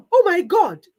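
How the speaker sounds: a sudden, high, loud gasp of alarm; gasping oh my God. A narrator's reading. Wife noticing she is late, gasping in alarm. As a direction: gasp it out, startled. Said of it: "almost gasping for breath".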